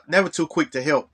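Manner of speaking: ordinary talk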